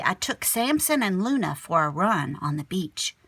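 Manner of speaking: ordinary delivery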